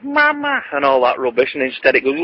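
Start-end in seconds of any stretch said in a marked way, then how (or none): 0.0-0.6 squeaky high doll voice; high toy doll "Mama"; doll saying mama. Retold or imitated. Doll expected to coo, in a high doll voice. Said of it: "expecting to go".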